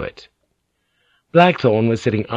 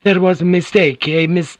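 higher, pleading lady's voice; Mariko; 8 semitones higher, 4 dB louder than usual. A narrator's reading. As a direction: urge him gently but firmly, higher.

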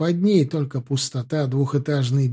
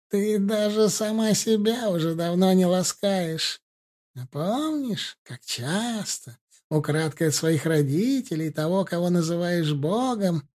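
taunting crone; 4 semitones higher than usual. Acted. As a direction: whisper filth like a cruel witch.

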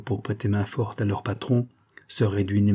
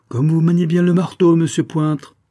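put-on higher, louder, no English accent; English accent gone; 7 semitones higher, 6 dB louder than usual. A narrator's reading. The unmasked visitor to his attacker, all English accent lost. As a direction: drop the English accent and call it out. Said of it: "d'une voix qui avait perdu tout accent anglais".

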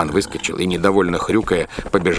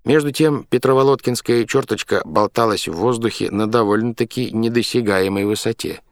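plain reading voice

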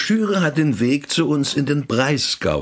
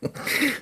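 scornful laugh; 6 dB quieter than usual. Acted. Priest mocks doctor's idea.

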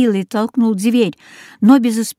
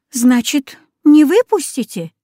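put-on frightened girl; 6 semitones higher, 4 dB louder than usual. A narrator's reading. Girl voiced.

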